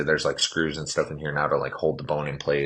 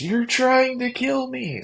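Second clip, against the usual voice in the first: high, slow retold scream, much higher; mock scream; 4 dB louder, slower than usual. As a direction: play back a panicked yell at the nurses, stretched out. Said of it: "You literally screamed at the nurses".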